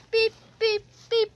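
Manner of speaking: high, loud imitated beeping; loud mechanical pretend beeps; alarm beeps. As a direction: beep evenly like an alarm.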